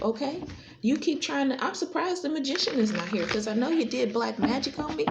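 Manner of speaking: ordinary talk, nothing marked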